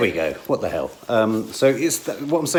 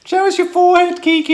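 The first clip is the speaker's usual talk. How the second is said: teasing; 6 dB louder than usual. Real, not acted. Teasing friend, high voice.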